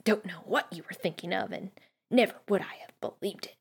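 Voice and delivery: whispered grumble under the breath; hushed, half-whispered grumbling; muttering. A narrator's reading. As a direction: mutter it crossly to yourself, barely voiced. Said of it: "she muttered things like".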